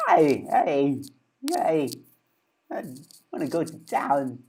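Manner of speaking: halting delivery with long pauses; Christopher Walken. Shown as a demonstration. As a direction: break it up word by word like an impressionist. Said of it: "Christopher Walken".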